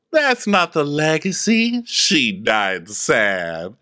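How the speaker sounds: Phaedra. Acted. Objecting to the legacy, very slow falling drawl. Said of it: "Phaedra goes".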